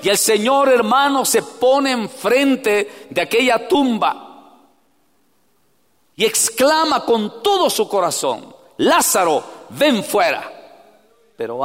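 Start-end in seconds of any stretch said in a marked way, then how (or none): 0.0-10.5 loud, high preacher cry; preaching at full force. Acted. Preacher urging the congregation to cry out to God, loud and high. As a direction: lift it into a pleading call.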